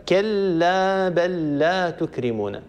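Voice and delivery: كلا بل لا تكرمون with بل لا merged into one doubled lam, slow; slow recited example. Shown as a demonstration. Teacher reciting an example of merged lam for students. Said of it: "لام ساكنة ولا متحركة".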